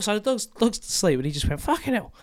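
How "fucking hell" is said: high, startled swear; dog talking like a man. Pretend. Dog voiced as human, joke.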